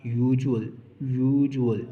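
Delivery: "यूजुअल" said loud with a clear y-sound at the start, falling; y-sound demo. Shown as a demonstration. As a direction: stress the y of "यूजुअल" as in "you". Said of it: "हमने sound पे देखना है".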